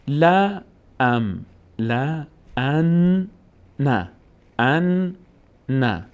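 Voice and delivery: slow, separated "لا ام، لا ان، نه"; syllable-by-syllable. Shown as a demonstration. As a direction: hold the n of "ان", then release "نه". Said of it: "یک بار به همراه حرکت خودش".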